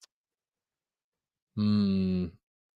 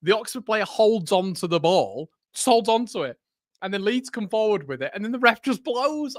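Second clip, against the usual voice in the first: play-by-play; 8 semitones higher than usual. Acted. Mock live commentary of match.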